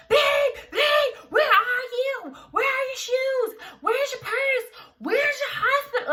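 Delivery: high, frantic husband's voice; hammed-up panicked husband rushing around; freaking out husband. Husband running around the house, high and frantic. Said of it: "he's freaking out".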